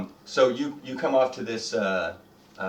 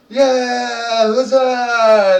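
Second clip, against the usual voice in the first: long, high, loud mob cheer; full-volume drunken goblin cheer; goblins screaming; 13 semitones higher, 14 dB louder, much slower than usual. Acted. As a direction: scream it out and stretch the last vowel. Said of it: "they're, like, screaming".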